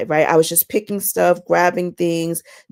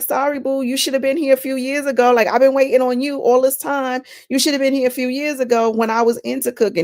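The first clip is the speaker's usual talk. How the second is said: higher, quick teasing voice; playful tease; 7 semitones higher, faster than usual. Pretend. Wife teasing her future husband for coming too late, playful and higher. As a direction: tease him sweetly for arriving late. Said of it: "I'ma be like".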